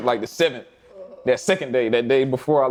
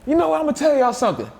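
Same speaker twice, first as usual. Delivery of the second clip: raised-pitch mock team telling-off; imitating exasperated coach; 6 semitones higher than usual. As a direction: coach addressing the room, higher than usual. Acting coach's exasperated speech to players.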